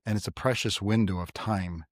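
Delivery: ordinary talk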